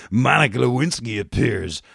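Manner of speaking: ordinary talk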